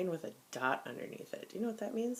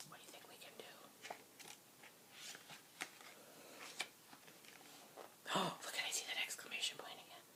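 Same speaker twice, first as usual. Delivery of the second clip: quick excited whisper with a gasp; 2 semitones higher, 14 dB quieter, faster than usual. Pretend. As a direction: breathe it out like a secret discovery. Suspenseful whisper to children.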